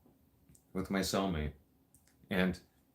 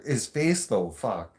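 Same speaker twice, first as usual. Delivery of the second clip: ordinary delivery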